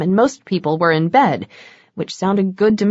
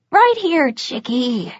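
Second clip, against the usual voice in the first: much higher, slow, sing-song lure; giggly vampire dealer; 6 semitones higher, slower than usual. A narrator's reading. Vampire coaxing her toward his car, high slow sing-song. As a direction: coax her along like a creepy playmate.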